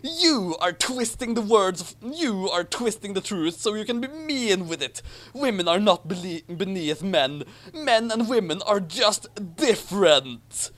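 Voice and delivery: mock brave Harry. A narrator's reading. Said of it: "Harry fired back bravely".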